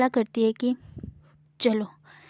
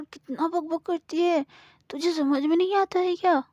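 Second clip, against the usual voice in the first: higher annoyed scolding; irritated girl; 6 semitones higher than usual. Acted. Samira, fed up with Gauri's chatter. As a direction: snap at a chatterbox.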